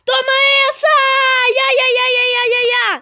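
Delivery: high, loud attack yell; excited battle cry. Real, not acted. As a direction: shout as you strike.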